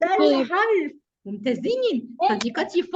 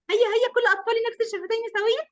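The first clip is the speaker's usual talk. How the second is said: excited urging; 6 semitones higher, faster than usual. Real, not acted. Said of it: "teacher urging the children".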